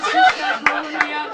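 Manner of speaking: ordinary delivery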